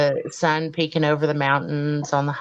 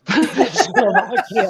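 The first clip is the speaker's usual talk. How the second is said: laughing echo; 5 semitones higher, 3 dB louder, much slower than usual. Real, not acted.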